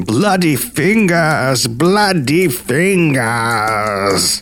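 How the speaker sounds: long drawn-out spooky wail; hammed-up campfire ghost moan; ghostly moan. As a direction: moan it slowly like a ghost, stretching each word. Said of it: "it was moaning".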